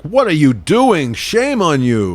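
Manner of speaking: slow, hammed-up telling-off; mock scolding. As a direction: scold with exaggerated, joking outrage. Mock shaming of a crier.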